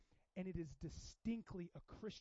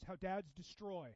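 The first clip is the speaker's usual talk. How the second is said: normal talking voice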